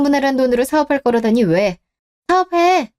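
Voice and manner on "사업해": mocking. Wife mocks her husband's business plans with sarcasm. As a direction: taunt him to go start his business.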